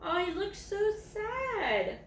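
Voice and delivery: ordinary delivery, nothing marked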